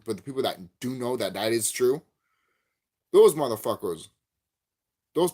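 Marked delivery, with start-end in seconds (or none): 3.1-5.3 loud outburst. Real, not acted. Rant peak.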